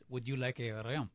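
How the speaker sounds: low, soft mock French clerk, insisting; French accent, firm and hushed. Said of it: "I said".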